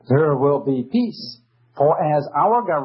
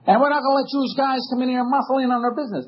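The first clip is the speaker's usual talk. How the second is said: mock judge, higher and fast; imitating the judge; 9 semitones higher, faster than usual. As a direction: rattle off the judge's threat, pitched up. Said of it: "He doesn't use those words quite like that".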